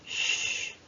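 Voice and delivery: ch said as a hushing sh sound; ch as in chat, sh. Shown as a demonstration. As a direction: make the ch sound, sh. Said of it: "les deux ensemble vont chanter".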